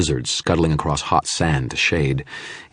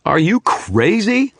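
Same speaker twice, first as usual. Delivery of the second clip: high, slow, stunned disbelief; stunned demand; 6 dB louder, slower than usual. A narrator's reading. Wizard confronting the shadow about the demon, stunned and high. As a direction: demand it in shock. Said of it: "I demanded, stunned".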